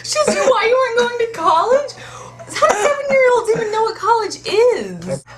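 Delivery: high, loud disbelief with laughter; incredulous. Real, not acted. Shocked at seven-year-old's question.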